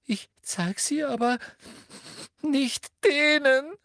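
put-on very high girl's refusal, rising to a squeal; shrill. A narrator's reading. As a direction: refuse shrilly, stretching the last words. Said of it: "sagte Hermine schrill".

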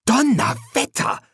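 high, bursting-out amazement; astonished exclamation. A narrator's reading. Pepper sees the officer sink to the floor, astonished. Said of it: "entfuhr es Pepper".

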